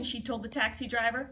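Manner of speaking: ordinary delivery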